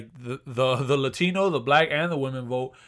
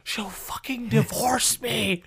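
high, drawn-out hammed-up desperation; mock panicked plea; 9 semitones higher, much slower than usual. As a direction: stretch it out high like a panicking husband. Joking as panicked secret Trump voter.

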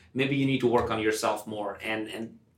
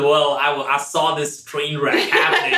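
mock mentor, laughing; 4 semitones higher, 6 dB louder than usual. Mentor voiced teasing.

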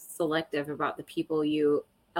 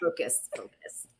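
whisper; 12 semitones higher, 5 dB quieter than usual. Real, not acted. Calming self after laughing.

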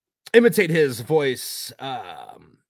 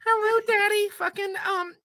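much higher falsetto purr for a phone fantasy; mock sultry falsetto.

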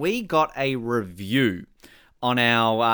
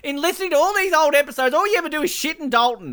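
mock outrage; 14 semitones higher, 6 dB louder than usual. Mock outraged defence of Dalton.

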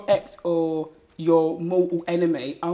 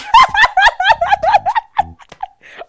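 loud high laugh; 13 dB louder than usual. Real, not acted. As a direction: burst into a loud, high cackle. Tipsy flirty date banter.

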